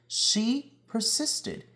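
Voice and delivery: persisted lifted high and slowed, stress on persisted; emphatic refrain. A narrator's reading. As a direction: land the refrain with pride, slowly.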